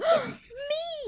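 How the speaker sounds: sharp delighted gasp; excited gasp. Retold or imitated. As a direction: gasp in mock surprise. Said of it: "And you're like".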